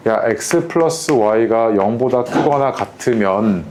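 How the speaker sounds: very slow, each term laid out; teacher slowly reading out an example statement; slow spoken example. Shown as a demonstration. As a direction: read the statement out slowly to the student. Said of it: "내가 너한테 이렇게 얘기해".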